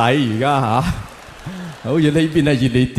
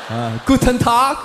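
mock German greeting; 8 semitones higher than usual.

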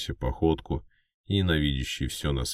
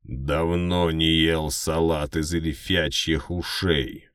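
orc growl; 2 semitones higher, slower than usual. Acted. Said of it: "прорычал орк".

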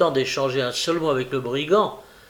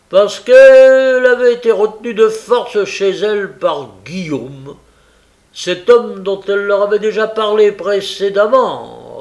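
loud, higher voice of a dim informer; Noé the informer; 6 semitones higher, 11 dB louder than usual. Acted. Noé recounting what she was asked, loud informer. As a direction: report it loudly, pitched up.

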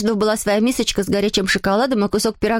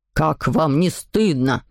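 low, slow fatherly rebuke; father crying out; 8 semitones lower, much slower than usual. A narrator's reading. Father shames the fighting children, in a low, slow cry. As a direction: scold in a deep voice, drawn out. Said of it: "закричал месье Патмой".

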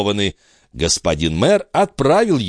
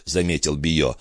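usual speaking voice

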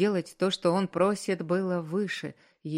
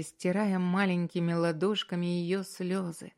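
usual speaking voice

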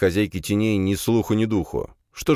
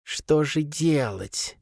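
slow, high creature mutter; 9 semitones higher, much slower than usual. A narrator's reading. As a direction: mutter it to herself slowly. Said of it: "пробормотала мать".

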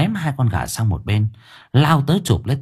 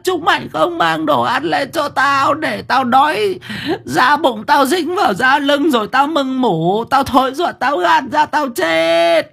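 put-on raging old grandmother. A narrator's reading. Starving grandmother cursing her grandson, shrieking high. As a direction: wail and curse at a very high pitch.

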